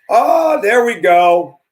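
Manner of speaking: loud, high gotcha shout; triumphant shout. Real, not acted. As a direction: shout it out like you caught them. Caught her saying it.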